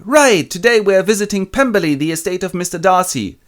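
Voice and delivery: high, loud chirpy announcement; cheery aunt. Retold or imitated. Aunt announcing the visit, high and loud. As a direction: announce the outing brightly. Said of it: "And they go".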